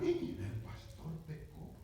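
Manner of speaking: slow, breathy whispered admission; hushed confession. Retold or imitated. Pastor confessing to the brethren in a slow whisper. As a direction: whisper it slowly like a shameful secret. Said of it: "les digo a los hermanos".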